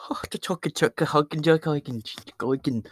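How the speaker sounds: garbled Irish-Scottish imitation; mock Irish-Scottish accent. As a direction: parody an Irish or Scottish accent with nonsense syllables. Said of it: "That's what you guys all sound like to me".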